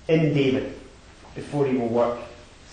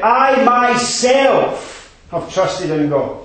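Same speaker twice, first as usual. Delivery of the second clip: slow, higher, louder on "I myself"; stress on I myself; 9 semitones higher, 7 dB louder, much slower than usual. Shown as a demonstration. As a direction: lean hard on "I myself", draw it out. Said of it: "You could read it".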